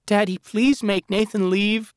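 put-on high, loud childlike plea; a daughter begging her dad; pleading daughter. A narrator's reading. Megan begging her father to send Nathan away, high and tearful.

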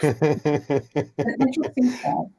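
slowed wry aside; wry knowing repeat. Real, not acted. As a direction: let the words hang with dry irony. Knowing repeat of own remark.

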